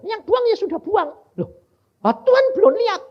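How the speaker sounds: high, loud and eager; eager exclamations. Retold or imitated. Preacher retelling his eager words to the Lord, high and loud. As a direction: urge it on, thrilled.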